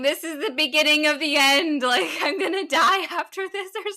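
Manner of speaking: loud, high, hammed-up child panic; rushed, laughing kid panic; mock doom. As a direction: play the drama of a tiny doomsayer. Mother voices daughter's dread.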